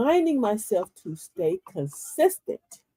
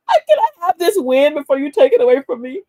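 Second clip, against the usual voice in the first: pleading; 7 semitones higher, 11 dB louder than usual. Retold or imitated. Pleading with doctor for his win.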